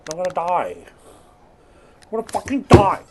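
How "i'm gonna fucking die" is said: louder, higher burst of real panic. Real, not acted. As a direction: blurt it out in alarm. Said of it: "repeated louder".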